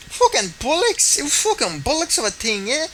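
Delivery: hammy Irish outrage, stretching the swear; Conor McGregor accent. Pretend. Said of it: "I love trying to do a Conor McGregor accent".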